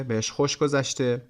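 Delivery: normal talking voice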